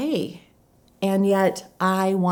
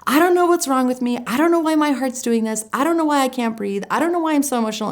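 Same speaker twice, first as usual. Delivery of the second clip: high, louder, rapid self-berating; frantic inner critic, pitched well up; self-judgmental panic; 8 semitones higher, 5 dB louder, faster than usual. Retold or imitated. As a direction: spill it out in a high, agitated rush. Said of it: "my first reaction was self-judgmental".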